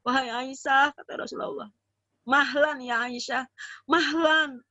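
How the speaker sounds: slow, gentle calming of an upset wife. Acted. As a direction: soothe her, drawing the words out. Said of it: "Maka Nabi bilang gini kepada Aisyah".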